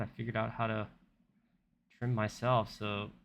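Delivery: usual speaking voice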